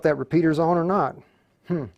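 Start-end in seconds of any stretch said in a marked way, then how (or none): none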